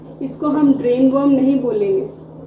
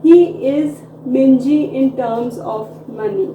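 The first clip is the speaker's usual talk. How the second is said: slow model sentence; 5 dB louder, much slower than usual. Shown as a demonstration. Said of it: "पैसे के मामले में वह बहुत कंजूस है".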